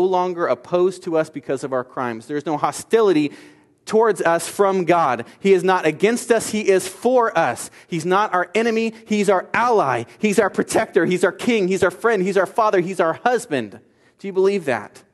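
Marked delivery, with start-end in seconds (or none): 2.3-13.8 rising, louder litany of who God is; building preaching. Acted. Preacher to congregation, building fervent litany. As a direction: preach each line harder, then ease on the last.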